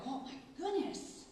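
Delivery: a lightly voiced, high-pitched "oh my goodness"; amazed gasp. Principal amazed by the kids following Mandarin, high and awed. Amazed watching kindergarten class.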